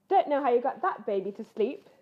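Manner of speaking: Missus Grizzle voice. Acted. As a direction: marvel as a grateful mum bear.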